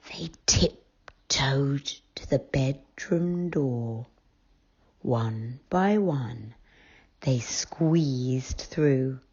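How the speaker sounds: whispered, slow storytelling; hushed narration. A narrator's reading. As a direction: breathe it like a secret.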